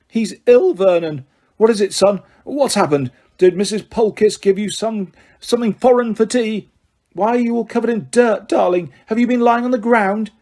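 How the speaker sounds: a mother coddling her sick son; worried parents. Acted. Petunia and Vernon fussing over son.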